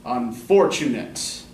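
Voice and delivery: slow, each syllable weighed, UN-for-tu-nate; scanned stress. Shown as a demonstration. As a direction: sound out Unfortunate slowly, syllable by syllable. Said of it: "tell you where the stresses fall".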